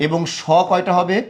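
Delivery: normal talking voice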